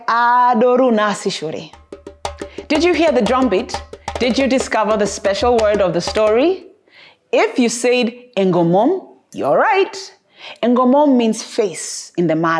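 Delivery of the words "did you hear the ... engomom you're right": ordinary delivery, nothing marked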